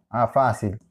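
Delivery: ordinary delivery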